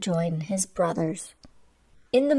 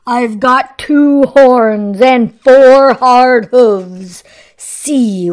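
big goat voice; 4 semitones higher, 13 dB louder, slower than usual. Acted. Big goat challenges troll.

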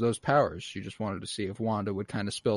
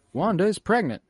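usual speaking voice

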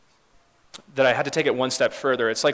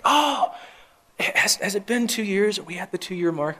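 higher, lightly surprised check-in; asking gently with raised pitch; surprised question; 10 semitones higher than usual. Retold or imitated. Pastor asking grieving parents about the anniversary, high and surprised. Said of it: "I was like".

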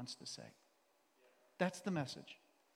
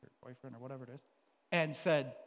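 normal talking voice

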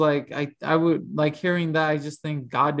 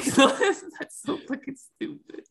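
high-pitched, laughing through the words; real giggly disbelief; cracking up; 11 semitones higher than usual. Real, not acted. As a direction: barely get it out for laughing. Cracking up at silly name.

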